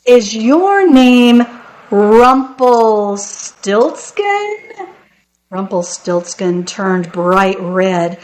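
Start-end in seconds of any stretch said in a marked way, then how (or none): 0.1-4.6 very slow, drawn-out higher-pitched question; teasing reveal. Acted. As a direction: stretch the name out for a triumphant reveal. Queen's slow, triumphant final guess.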